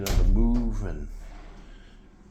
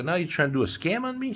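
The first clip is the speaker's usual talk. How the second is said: indignant accusation; 10 semitones higher than usual. Retold or imitated. Confronting scamming cab driver.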